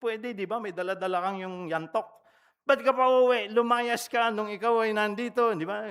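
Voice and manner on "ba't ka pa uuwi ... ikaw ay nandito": higher, louder reproachful scolding; scolding father. Retold or imitated. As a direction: scold the returning son like an angry father. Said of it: "Mga ganong mga panunumbat". (galit na paninisi ng ama)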